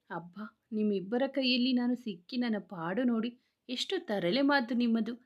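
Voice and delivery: plain reading voice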